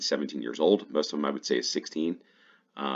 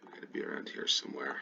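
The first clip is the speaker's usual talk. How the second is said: muttering to himself; 2 semitones lower, 10 dB quieter, faster than usual. Real, not acted.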